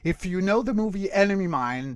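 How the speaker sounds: ordinary talk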